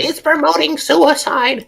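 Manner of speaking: high, drawn-out cry of alarm; mock alarmed mom. Interrupting a friend's story as a panicking mom, mock alarm. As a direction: wail it slowly, pitched right up.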